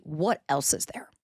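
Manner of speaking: ordinary talk, nothing marked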